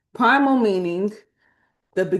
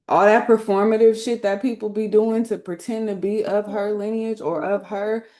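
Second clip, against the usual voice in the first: usual speaking voice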